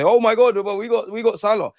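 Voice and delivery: hammed-up rival fan, put on for laughs; a taunting impression of a Liverpool fan bragging; mock Liverpool fan. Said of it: "random Liverpool fans will come and say".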